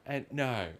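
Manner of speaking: normal talking voice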